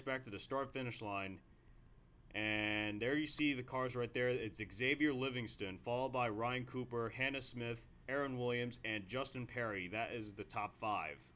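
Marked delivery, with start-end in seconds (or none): none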